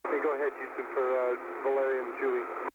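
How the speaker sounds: crackly space-to-ground radio; astronaut radio. Real, not acted. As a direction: talk through a distant radio link. Crew answers ground over radio.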